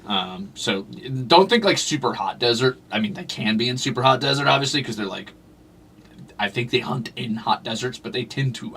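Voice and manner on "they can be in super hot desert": ordinary delivery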